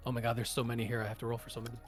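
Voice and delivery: ordinary delivery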